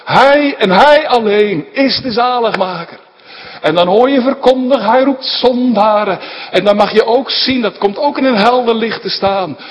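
loud, high, impassioned sermon voice; preaching at full force. Acted. Preacher urging his congregation, fervent full-voiced preaching. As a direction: build to a fervent preaching peak.

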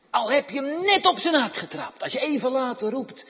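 loud, high, fast pulpit cry; full-force preaching. Acted. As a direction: drive the point home to the congregation.

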